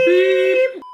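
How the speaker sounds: high, sustained beep noise; imitated beep. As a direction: make a high censor-style beep with the voice. Censor beep imitated after swearing.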